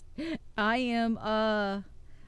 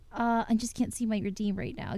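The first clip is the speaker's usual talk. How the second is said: ordinary talk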